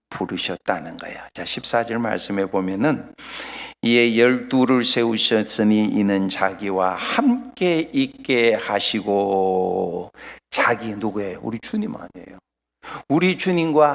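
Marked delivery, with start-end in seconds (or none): 3.8-10.1 slow, low scripture reading. Real, not acted. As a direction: draw the verse out solemnly, stretching the ending. Reads the Bible verse aloud. (느리고 낮게 끝을 늘이는 봉독)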